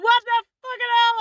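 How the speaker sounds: high, loud incredulous yell; retold outrage. Storyteller replaying a row with mum, outraged yell. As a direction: re-enact blowing up at mum.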